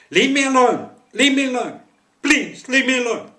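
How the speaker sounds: high, shrieking plea at full volume; terrified screaming. Retold or imitated. Woman across the street begging attacker, desperate shriek. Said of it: "and she's screaming".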